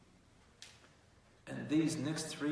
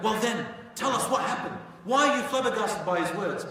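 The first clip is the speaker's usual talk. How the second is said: pressing demand; 7 semitones higher, 8 dB louder than usual. Acted. Abu Jahl pressing Al-Walid.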